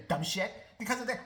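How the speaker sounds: normal talking voice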